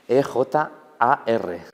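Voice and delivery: slow, spaced letter names; spelling out. Shown as a demonstration. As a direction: spell it out at half speed. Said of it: "deletréame". (deletreando despacio)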